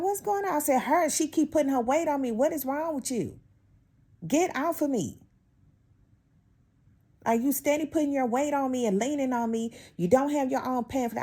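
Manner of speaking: high, worked-up confrontation; heated retelling. Retold or imitated. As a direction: pitch high, replay the fight. Retelling argument with coworker.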